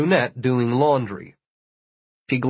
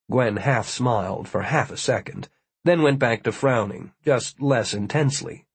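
plain reading voice

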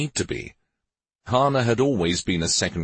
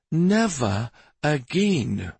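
high, drawn-out words; slow, emphatic vow; 8 semitones higher, much slower than usual. A narrator's reading. Clasping his face and vowing, slow and emphatic. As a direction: stretch each word out as a solemn vow.

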